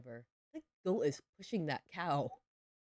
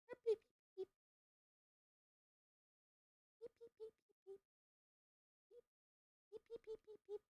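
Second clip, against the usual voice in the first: much higher, squeaky playful beeps; silly beeping; 7 dB quieter, faster than usual. Pretend. Gamer beeps at her farm animals, squeaky and silly. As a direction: chirp it like a toy.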